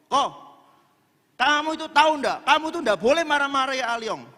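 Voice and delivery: angry rebuke; angry scolding. Shown as a demonstration. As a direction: scold him in an angry tone while telling him not to be angry. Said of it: "tapi nada suara saya marah ndak?".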